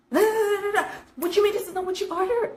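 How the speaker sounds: waitress shouting at a customer, imitated angrily; retold shout. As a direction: mimic the waitress snapping back. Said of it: "the lady that was shouting there".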